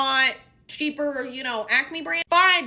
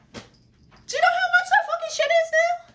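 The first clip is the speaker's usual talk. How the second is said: much higher, fast squeal of disbelief; outraged disbelief; faster than usual. Real, not acted. Shopper squealing in outrage at cereal prices.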